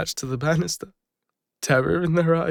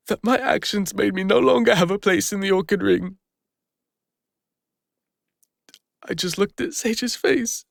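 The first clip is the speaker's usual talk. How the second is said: rising distress; 5 semitones higher than usual. Acted. Recalling being expelled from group.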